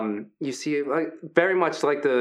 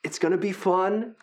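friendly hulking Brutus. Acted. Big gentle wrestler Brutus welcoming the new fighters, upbeat. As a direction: play the big guy as sweet and encouraging.

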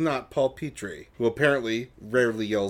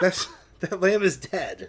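laughing disbelief; 8 semitones higher than usual. Real, not acted. Repeated, louder, laughing.

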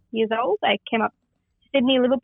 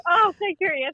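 laughing; 8 semitones higher than usual. Real, not acted. Guest teases host, laughing.

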